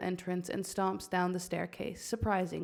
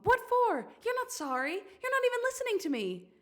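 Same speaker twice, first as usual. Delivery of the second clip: sharp, high, angry retort; angry snap; 3 dB louder, faster than usual. Acted. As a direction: snap back at Scar. Mom snapping at Scar.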